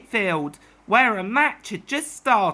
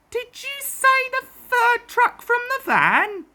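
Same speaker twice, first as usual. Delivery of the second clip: much higher, astonished question; surprised question; 13 semitones higher than usual. Acted. Surprised crew asking the cricketers, high astonished question. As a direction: ask it in disbelief.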